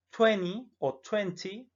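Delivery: "twenty" said slowly, the T dropped then sounded; "twenty" first as "tweny", then with a clear T. Shown as a demonstration. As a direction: model both versions of "twenty". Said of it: "esta T, no la pronunciemos".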